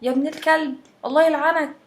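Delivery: mother's mock yell. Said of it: "وتقعد بقى تزعق".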